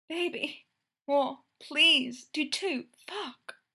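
pleading, voice cracking on the end; breaking voice on the last word. Acted. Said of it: "her voice cracking on the last syllable".

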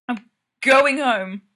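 half-yell it while fleeing; near yell. A narrator's reading. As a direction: brother bursting in, half-yelling as he leaves. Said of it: "Geordie all but yells".

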